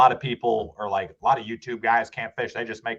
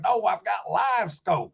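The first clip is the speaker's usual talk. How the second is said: slow, higher mock old-timer voice; imitating old angler; 7 semitones higher, slower than usual. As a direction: say it slowly, like the oblivious local who is proud of his gear.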